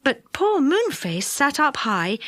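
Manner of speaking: ordinary delivery, nothing marked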